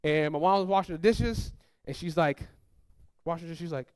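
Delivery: ordinary talk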